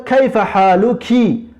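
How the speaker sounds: کیف حالوکی slowed, final ی clear; slow Arabic demo. Shown as a demonstration. Teacher models Khawla asking a woman, slow and clear. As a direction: read کیف حالوکی slowly, leaning on the ending.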